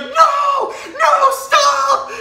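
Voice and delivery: high, loud panicked "No, no, stop!"; retold shout. Store clerk yelling at kids ducking under the gate, panicked high shout. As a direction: yell it like a gate lady freaking out. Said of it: "she's like".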